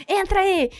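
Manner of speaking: high action-movie call from a speedboat; urgent command. Retold or imitated. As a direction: imitate a film hero ordering someone aboard. Action-film boatman calling out.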